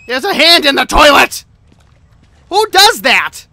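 loud disgusted exclamation; shocked outburst. Real, not acted. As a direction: blurt it out in shock. Shocked discovery in toilet.

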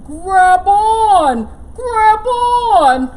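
loud urging call; bear calling grab on. Acted. Sun bear calling to critters to grab on.

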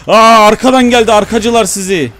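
high, loud startled yell; alarmed shout. Real, not acted. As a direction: yell out in surprise. Enemies attack from behind.

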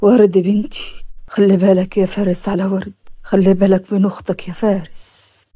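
low, breath-starved last words; dying father's low, effortful voice; labored dying words. A narrator's reading. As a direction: speak low, as if struggling for breath. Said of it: "الكلمات وهو بيتنفس بصعوبة".

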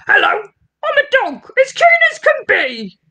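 squeaky keen cartoon dog; eager dog voice. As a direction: chirp it like an excited little dog. Teacher voices the dog character.

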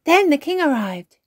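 put-on high, loud child's cheer. A narrator's reading. Peppa keeping Granny's story going, cheering. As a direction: burst in gleefully with the next event. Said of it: "cheered Peppa".

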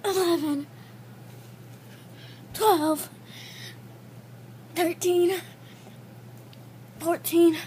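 straining, breathless count; doing push ups. Real, not acted. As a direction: count out loud through the strain. Push-ups counted during one minute.

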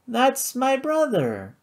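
put-on high, slow drunken slur; slurred. Flora to the judge, slurring drunkenly. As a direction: let the words smear drunkenly. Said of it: "she slurred".

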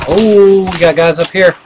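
high, loud urgent callout; excited callout. Real, not acted. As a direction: shout the warning to the squad. Calling out enemies.

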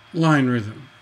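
normal talking voice

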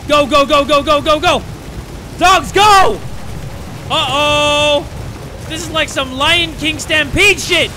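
high, very loud yells; real startled gamer yelling; panicked shouting. Real, not acted. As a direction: scream the dogs onward in alarm. Stampede in game.

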